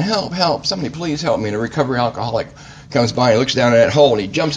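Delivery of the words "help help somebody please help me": a mild acted cry for help; mock scream for help. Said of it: "he's screaming for help again".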